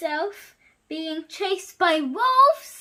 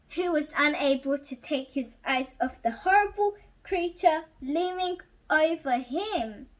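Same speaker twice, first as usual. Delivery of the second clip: usual speaking voice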